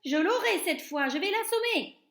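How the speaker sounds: high, loud, panicked cook voice; frantic shout. A narrator's reading. Cook swatting at his trouser leg, high loud panic. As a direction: threaten the mouse in a panic. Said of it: "cria l'homme".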